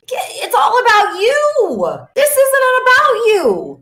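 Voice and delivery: emphatic rebuke. Real, not acted. Coach scolding self-absorbed sellers, emphatic rebuke. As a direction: call them out in a high, insistent voice.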